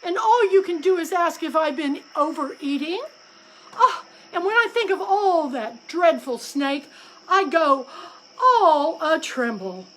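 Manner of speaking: high, loud, weepy rant; distraught wife crow near tears; tearful complaint. A narrator's reading. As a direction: sob out the grievance. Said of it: "once again burst into tears".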